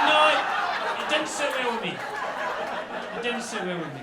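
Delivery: loud, high wail of protest; outraged wail. Acted. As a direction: cry out in comic outrage. Outraged comic rant about the dogs.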